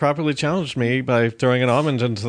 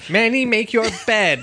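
Sofia Vergara; 9 semitones higher than usual. Retold or imitated. Comedian mimics sitcom mom to Manny, high exaggerated accent. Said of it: "the Modern Family lady".

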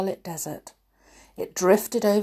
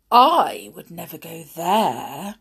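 louder, slow haughty denial; snobbish; 5 dB louder than usual. Acted. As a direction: look down your nose at it. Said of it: "From his snobbish response".

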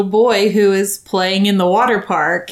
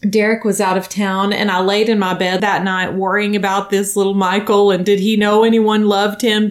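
normal talking voice

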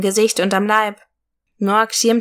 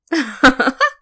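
evil fairy cackle; 5 semitones higher than usual. A narrator's reading. Evil fairy answers the warrior.

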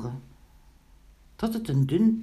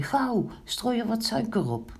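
higher-pitched hurrying order; urgent call; 7 semitones higher than usual. A narrator's reading. Pinkeltje tells Kraaloogje to add sugar, high urgent call. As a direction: call out to a helper. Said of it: "riep Pinkeltje tegen Kraaloogje".

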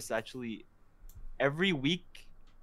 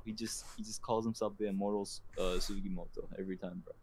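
usual speaking voice

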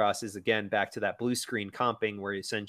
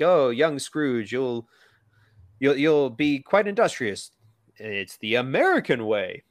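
slow, louder, pompous eagle voice saying quite industrious; Sam the Eagle; 3 semitones higher, 5 dB louder than usual. Retold or imitated. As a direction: puff up and lecture young Scrooge grandly. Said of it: "he's like".

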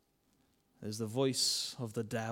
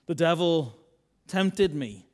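louder, higher protest; loud confession; 3 semitones higher, 8 dB louder, slower than usual. Retold or imitated. Adam confessing to God, loud protest. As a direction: blurt out the blame. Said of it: "they do accept responsibility".

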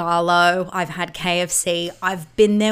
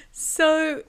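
ordinary delivery

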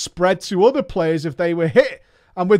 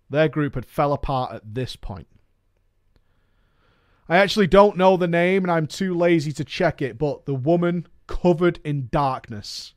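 usual speaking voice

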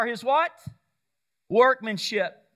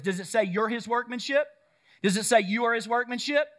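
ordinary talk

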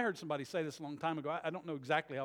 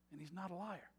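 quiet payoff; 2 semitones higher, 9 dB quieter than usual. Acted. Sudden drop after the shout.

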